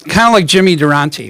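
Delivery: ordinary talk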